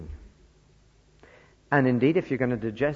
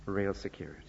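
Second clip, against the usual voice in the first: soft echo; 6 semitones lower, 9 dB quieter, faster than usual. Real, not acted. Soft repeat for emphasis.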